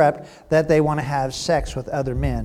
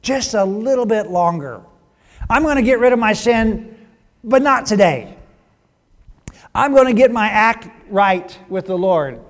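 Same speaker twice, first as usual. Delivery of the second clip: lightly voiced procrastinator, raised pitch; lollygagger; 6 semitones higher, 6 dB louder than usual. Sinner putting off change, lollygagger's promise. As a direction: make loud, high promises for some later day. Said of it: "the epitome of the lollygager".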